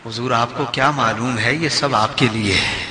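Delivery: soft, low, deferential address; humble and hushed; reverent reading. Shown as a demonstration. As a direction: tell an honoured guest gently, voice dropped. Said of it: "اور ایک انداز یہ ہے".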